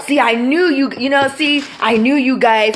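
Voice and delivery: high, loud taunting "see, I knew it"; sing-song told-you-so jeer; mocking gotcha. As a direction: gloat like a smug critic who caught you out. Mocking critics who catch Christians slipping.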